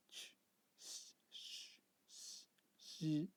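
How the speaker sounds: isolated CH, S, SH hisses, each held alone; sibilant sounds. Shown as a demonstration. Teacher listing sibilant endings to learners, isolated hissing sounds. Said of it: "has sibilant ending, such as".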